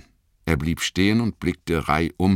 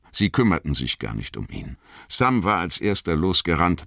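ordinary delivery

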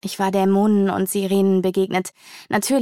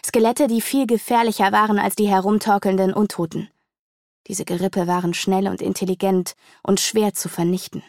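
plain reading voice

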